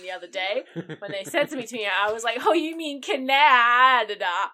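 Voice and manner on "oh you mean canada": Canada slowly and loudly mispronounced; drawn-out, loud Canada, said the wrong way; odd pronunciation of Canada. Retold or imitated. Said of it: "how to pronounce Canada".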